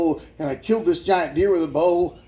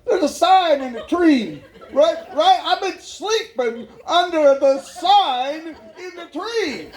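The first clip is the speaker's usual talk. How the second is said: high, drawn-out exclamation of disbelief; amazed realisation; 8 semitones higher, slower than usual. Retold or imitated. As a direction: stretch it out high, as if just discovering it. Acts out his astonished discovery.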